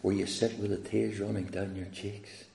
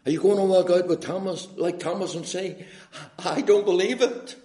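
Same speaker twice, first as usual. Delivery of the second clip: high, raised preaching voice; forceful challenge; 9 semitones higher, 6 dB louder than usual. Acted. Preacher challenging the church with Thomas's doubt, forceful and high. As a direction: build to a defiant cry of disbelief.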